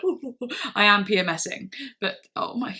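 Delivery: ordinary delivery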